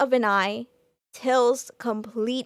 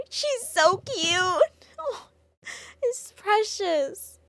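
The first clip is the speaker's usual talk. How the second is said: real tears, slow and much higher; crying; 8 semitones higher, much slower than usual. Real, not acted. Moved to tears watching the reunion, crying. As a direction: choke up over how cute they are. Tearful over a touching scene.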